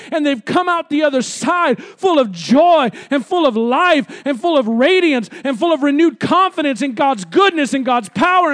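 high, loud preaching at full force; impassioned preaching. Real, not acted. Preacher urging the congregation not to live alone, impassioned preaching.

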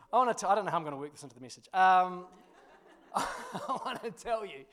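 ordinary delivery

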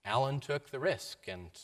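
normal talking voice

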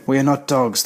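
usual speaking voice

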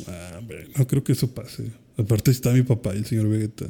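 soft, offhand mock brush-off; unbothered Gohan. Gohan answering a worried Piccolo, casually dismissive. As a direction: imitate a laid-back shrug, kept quiet. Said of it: "Y el Gohan de que".

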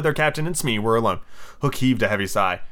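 usual speaking voice

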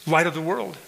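normal talking voice

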